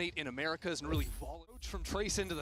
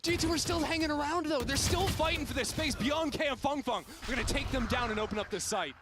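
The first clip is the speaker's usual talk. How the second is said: higher, louder fast caster call; excited play-by-play; 9 semitones higher, 5 dB louder than usual. Real, not acted. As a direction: ride the action as it unfolds. Caster calling the fight.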